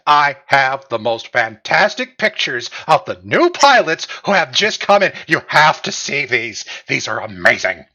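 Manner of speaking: loud, eager pitch to the publisher; thrilled newsman calling his boss; excited photographer. Acted. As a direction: sell the pictures breathlessly. GM voices excited newspaper guy.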